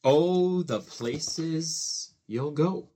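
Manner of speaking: plain reading voice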